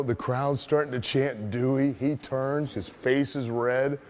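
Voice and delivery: usual speaking voice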